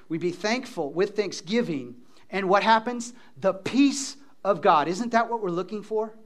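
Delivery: ordinary talk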